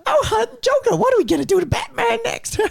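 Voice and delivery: very high, hammed-up cartoon villainess; Harley Quinn. Asking the Joker about Batman, high mock cartoon voice. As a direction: squeal it like a grandma-ish comic-book moll. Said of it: "attempt at a Harley Quinn impression".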